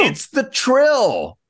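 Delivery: high, drawn-out announcement; excited reveal. Real, not acted. Host reveals the mystery man to co-hosts, excited and drawn out. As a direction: stretch it out slowly and high, as a big reveal.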